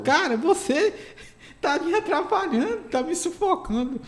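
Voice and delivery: high, small voice, hesitant complaint; sem jeito, awkward plea. Acted. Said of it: "você fica sem jeito de falar assim". (voz aguda e sem jeito)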